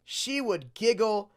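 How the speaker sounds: ordinary talk, nothing marked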